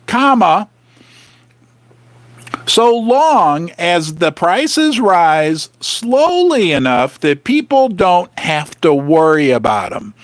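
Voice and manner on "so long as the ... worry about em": very slow, word by word; slow deliberate reread. Shown as a demonstration. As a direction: drag it out to expose it. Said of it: "listen to that paragraph one more time".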